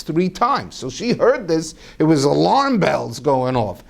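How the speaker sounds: ordinary delivery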